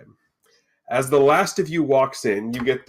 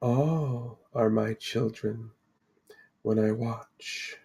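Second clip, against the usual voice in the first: slow, soft, soothing seer; serene Lady of the Lake; 7 dB quieter, slower than usual. Acted. Mystic lady to the wary party, serenely calming. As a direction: soothe them softly, with long pauses. Game master voices the Lady.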